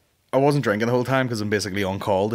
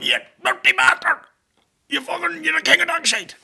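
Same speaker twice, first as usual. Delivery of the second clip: shouting traveller; 3 dB louder than usual. Retold or imitated. Call-out video rant.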